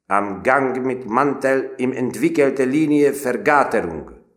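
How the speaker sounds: high, loud rasping drill order; barking Prussian drill sergeant; crackling German command. A narrator's reading. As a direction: crackle it at the recruits. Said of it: "recsegett ránk".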